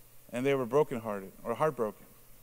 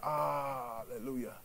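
drawn-out cry held down, trailing softly into praise; soft, mock-pained hallelujah; retold pained hallelujah; 9 dB quieter, slower than usual. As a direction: act out turning a hurt "ah" into hallelujah. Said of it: "I gave a genuine hallelujah".

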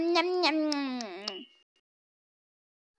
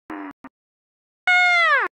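sudden high-pitched shriek; high squeal; 11 semitones higher than usual. Pretend. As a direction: yelp in mock alarm. Toy cries out in play.

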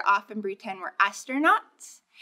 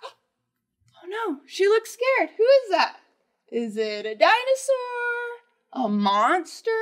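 mock scared; 7 semitones higher than usual. Reading scared moment in book.

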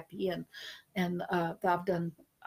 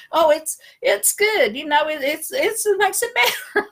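squeaky, slowed family reassurance; mock polite praise; 9 semitones higher, 6 dB louder than usual. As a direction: fake being nice about bad food. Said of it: "they're like".

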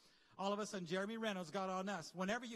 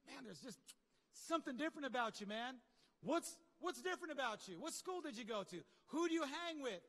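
curious onlooker; 6 semitones higher than usual. Retold or imitated.

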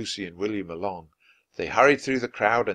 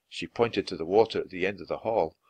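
usual speaking voice

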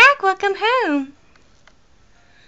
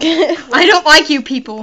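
mock grumpy; 3 semitones higher, 9 dB louder than usual. Grumpy mock line at villagers.

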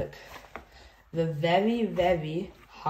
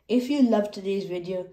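ordinary talk, nothing marked

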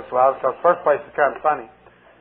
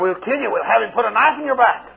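higher, menacing threat; demon threat; 6 semitones higher than usual. Retold or imitated.

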